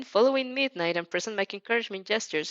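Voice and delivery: usual speaking voice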